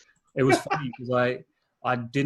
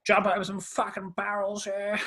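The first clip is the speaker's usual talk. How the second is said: imitating a tipsy Aussie Ocker, pitched up; 8 semitones higher than usual. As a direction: put on a broad Aussie larrikin voice. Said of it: "real Aussie Ocker voice".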